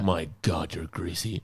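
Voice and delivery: low, soft cartoon impression; squeaky voice teen bit. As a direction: drop the voice and hush the line. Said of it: "works at Krusty Burger".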